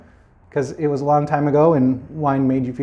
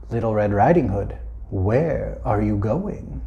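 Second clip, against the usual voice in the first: wolf; 7 semitones lower, 5 dB quieter than usual. Acted. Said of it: "and said".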